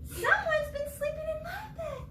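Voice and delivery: high crying Mama Bear voice. Acted. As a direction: wail it high like a fussing mother bear. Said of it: "Mama Bear's line".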